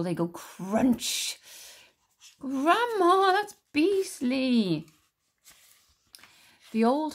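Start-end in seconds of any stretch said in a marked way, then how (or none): 0.0-1.4 very slow, drawn-out crunch; relished crunch. Acted. Grandma relishes beetles. 2.4-4.9 higher, louder, very slow shudder; disgusted boy. Acted. George disgusted.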